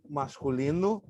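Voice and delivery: usual speaking voice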